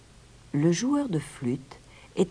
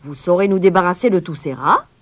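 loud, higher-pitched question; questioning bystander; 4 semitones higher, 10 dB louder than usual. A narrator's reading. Someone in the crowd questions the stranger, loudly. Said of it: "demanda quelqu'un dans la foule".

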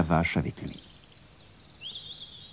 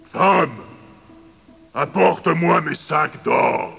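higher, slow commanding giant; giant's order; 9 semitones higher, much slower than usual. A narrator's reading. Giant demands his bags of gold. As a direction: bark the command slowly.

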